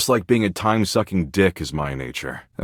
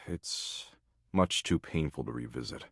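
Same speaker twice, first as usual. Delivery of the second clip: low and much softer, pained; pained, quiet; 4 semitones lower, 13 dB quieter than usual. Acted. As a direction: let it sink, hushed and reluctant. Too painful to recall.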